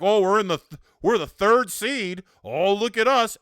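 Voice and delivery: loud, mocking self-satisfied fan; smug mock boasting. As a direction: ham up the proud fan bragging. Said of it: "and then we're like".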